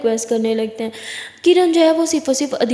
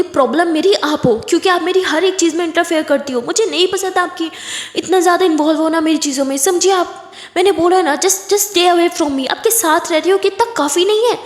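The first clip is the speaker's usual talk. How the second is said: higher, heated outburst; angry shouting; 5 semitones higher than usual. Acted. Aditi telling Kiran to back off, angrily. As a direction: push them away with raised, irritated voice. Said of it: "चिल्लाने की ज़रूरत नहीं है".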